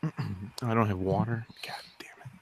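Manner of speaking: hushed unvoiced whisper. Real, not acted. Baby asleep behind the wall.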